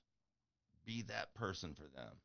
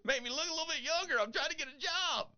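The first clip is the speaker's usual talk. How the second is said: high squeaky mock voice, quick at times; retold self to barber; 13 semitones higher, 4 dB louder than usual. Telling the barber to shave it, in a high mock voice. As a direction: play yourself as a silly squeaky customer. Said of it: "I go".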